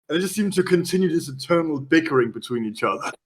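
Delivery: ordinary delivery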